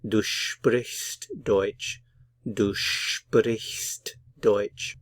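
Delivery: slow, every word of Du sprichst Deutsch clear; slow model sentence. Shown as a demonstration. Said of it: "So put it all together".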